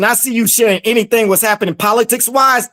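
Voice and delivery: ordinary delivery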